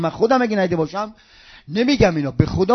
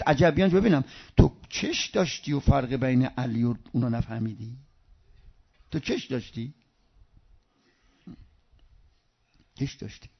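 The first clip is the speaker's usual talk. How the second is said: quiet rebuke; 6 semitones lower, 7 dB quieter than usual. Acted. God answers the blind man.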